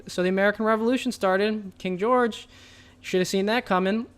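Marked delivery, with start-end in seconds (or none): none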